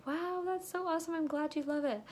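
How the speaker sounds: high-pitched gushing approval; enthusiastic praise. Acted. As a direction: gush along with a happy customer. Models reply to happy customer.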